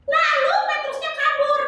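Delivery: put-on high, sing-song little girl voice; chirpy kid puppet answering the pastor; high child puppet voice. Tiur guesses the story.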